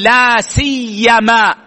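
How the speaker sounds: لا سيما slow, the ي doubled; teacher models لا سيما with a geminated ي; pronunciation demo. Shown as a demonstration. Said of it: "التشديد والتخفيف". (لا سيما بتشديد الياء)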